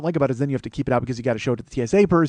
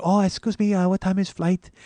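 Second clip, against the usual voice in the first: put-on high, polite Japanese-accented question; raised, meek Japanese accent; soft Japanese accent; 6 semitones higher than usual. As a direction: imitate a small, courteous Japanese businessman asking the time. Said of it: "gonna do that Japanese voice".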